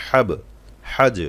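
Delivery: হাব and হাজ with bounced final consonants; qalqalah. Shown as a demonstration. Teacher drilling qalqalah, echoed endings on হাব and হাজ. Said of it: "এই যে কলকলা করলাম".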